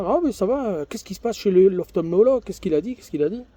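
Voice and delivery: imitating the doctor's eager surprise; doctor's surprised questions.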